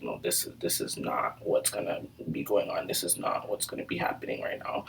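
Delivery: low, creaky near-whisper; hushed disbelief. Retold or imitated. Telling friends this is not happening, hushed disbelief. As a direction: say it under your breath, very low. Refusing to accept the situation.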